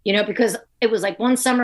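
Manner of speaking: ordinary talk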